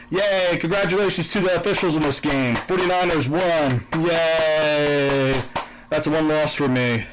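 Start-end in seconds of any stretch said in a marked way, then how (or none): 0.1-5.4 sarcastic cheer. Real, not acted. Bettor sarcastically congratulating the referees, mock cheer. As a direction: fake celebration for the officials.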